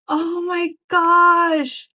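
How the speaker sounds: excited shriek. Real, not acted. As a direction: shriek it with delight. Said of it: "I'm freaking out".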